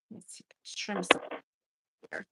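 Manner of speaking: soft whisper to herself. Real, not acted. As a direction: whisper while trimming. Unvoiced whisper while working.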